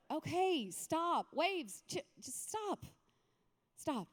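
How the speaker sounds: lightly acted weary command, in short pieces; sleepy Jesus. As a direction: tell them off softly and half-awake. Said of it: "Sleepy Jesus wakes up".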